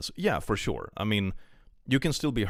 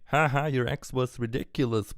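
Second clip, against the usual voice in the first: lightly mocking, high and breezy; a hint of a playful jab; joking tone; 6 semitones higher than usual. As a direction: toss it off like a throwaway joke. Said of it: "I really meant the comment as a".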